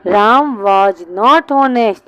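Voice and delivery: बहुत धीरे, not पर ज़ोर; वाक्य को खींचकर लिखवाओ; slow dictation. Shown as a demonstration. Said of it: "ईमानदार को कहते हैं". (क्लास को अंग्रेज़ी वाक्य धीरे से लिखवाता टीचर)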